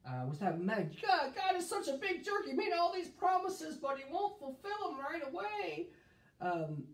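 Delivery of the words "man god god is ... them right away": mock complaint. Mocking their own complaint against God.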